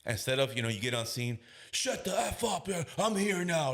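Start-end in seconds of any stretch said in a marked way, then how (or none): 1.7-3.7 high-pitched, drawn-out bossy cop act; mock aggressive command. Cop bursting onto the scene, mock aggressive command. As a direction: take over the scene with a strained, high voice. Bad cop entrance acted out.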